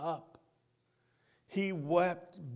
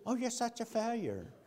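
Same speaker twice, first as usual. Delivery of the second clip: taunting; 4 semitones higher, 9 dB quieter than usual. Acted. Satan taunting the believer.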